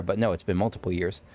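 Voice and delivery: usual speaking voice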